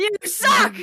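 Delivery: loud playful yell; shouting. Acted. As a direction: yell it at your friend. Party quarrel in character.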